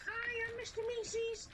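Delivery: high mock announcer voice; silly character voice. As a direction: pitch up into a goofy persona. Put-on character voice.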